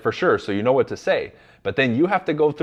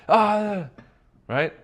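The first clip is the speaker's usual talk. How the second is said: angry; 10 semitones higher than usual. Shown as a demonstration. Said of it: "match their level of anger".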